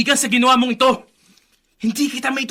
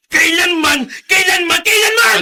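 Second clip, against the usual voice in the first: repeated cry, climbing higher and louder each time; rising crying shout; 5 semitones higher, 5 dB louder than usual. Acted. Son screaming at his father, louder each cry. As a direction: scream it again and again, breaking.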